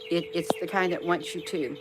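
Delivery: usual speaking voice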